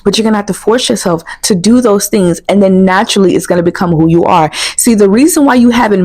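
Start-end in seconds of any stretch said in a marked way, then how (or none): none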